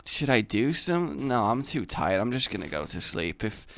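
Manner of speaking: low, soft, half-asleep muttering; tired shrug in the voice, soft and low; sleepy resignation. Acted. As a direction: give up and drift back toward sleep. Sleepily talks self out of acting.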